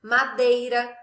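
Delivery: "madeira" with a loud DEI in the middle; stress on penultimate syllable. Shown as a demonstration. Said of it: "sílaba tônica na penúltima sílaba".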